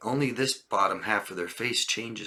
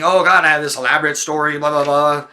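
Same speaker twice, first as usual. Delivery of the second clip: louder, higher, hurried over-explaining; manipulative storytelling; 5 semitones higher, 9 dB louder than usual. Acted. Teacher playing manipulative husband to wife, over-explaining. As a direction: gush out an elaborate cover story. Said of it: "I'm being manipulative, and I'm saying".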